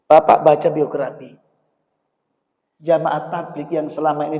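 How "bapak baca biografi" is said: ordinary talk, nothing marked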